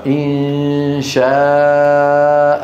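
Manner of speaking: إن شاء with the long alif held slowly; elongated madd. Shown as a demonstration. Teacher demonstrating the madd, stretched slowly. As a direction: draw out إن شاء in recitation style. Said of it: "أيوة".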